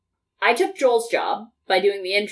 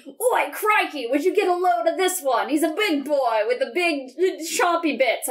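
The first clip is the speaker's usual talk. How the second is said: higher-pitched, hammed-up Aussie wildlife spotter; bad Australian accent; 5 semitones higher than usual. Zoologist spots a big croc, hammed-up Australian accent. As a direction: attempt a broad Australian accent, badly. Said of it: "I can't do an Australian accent".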